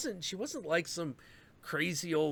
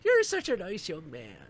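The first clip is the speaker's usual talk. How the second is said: lightly voiced sweet old lady, higher; a gentle grandma paying a compliment; 7 semitones higher than usual. Old lady praising him.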